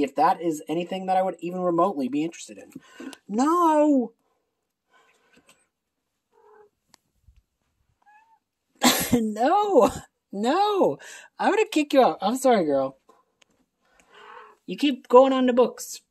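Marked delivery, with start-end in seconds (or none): 3.3-12.2 scolding cat. Real, not acted. Cat on the books again. 12.2-16.0 high sing-song pet talk. Real, not acted. Talking to the cat.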